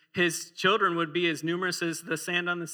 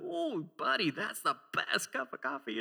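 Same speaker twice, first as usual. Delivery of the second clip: higher, soft dad faking delight over bad coffee; fake delight; 6 semitones higher, 6 dB quieter than usual. Dad faking delight to his son, softly higher. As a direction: gush gently as if it were wonderful. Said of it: "we have to".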